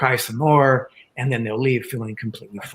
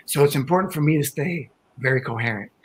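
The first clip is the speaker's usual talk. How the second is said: ordinary talk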